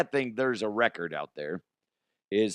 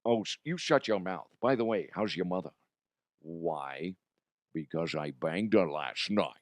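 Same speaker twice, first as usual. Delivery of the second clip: Sean Connery; 3 semitones lower, 3 dB quieter than usual. Acted.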